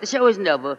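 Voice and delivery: usual speaking voice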